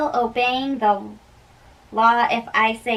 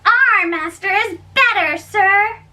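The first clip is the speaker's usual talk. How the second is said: high, defiant brag; a servant boasting to the rival household; 9 semitones higher than usual. Acted. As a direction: crow that your side is better.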